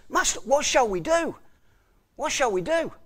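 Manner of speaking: high, anxious mock plea; frightened servant. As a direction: voice the scared servant asking twice. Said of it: "he's frightened to death".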